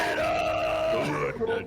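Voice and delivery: shouting. Real, not acted. Cheering a heavy metal answer.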